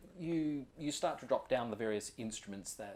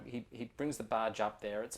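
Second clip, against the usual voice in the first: ordinary talk, nothing marked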